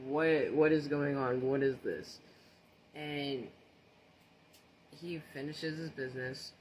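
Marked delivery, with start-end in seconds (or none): 0.1-2.2 startled confusion. Retold or imitated. Teen baffled by her grandfather in the bathroom, loud startled confusion. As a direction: blurt it out, thrown by what you see.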